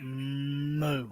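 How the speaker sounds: low-key quiet cow moo. Pretend. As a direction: moo softly like a lazy cow. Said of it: "hear that Canadian cow again".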